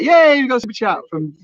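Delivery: high, loud "yay" of delight; excited cheer. Real, not acted. Host thanks a viewer for a super chat, excited.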